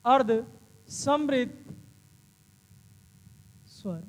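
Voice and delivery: "अर्ध समवृत" said slowly, word by word, for copying; slow, careful classroom repetition; slow dictation. Shown as a demonstration.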